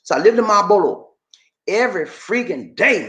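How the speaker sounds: ordinary talk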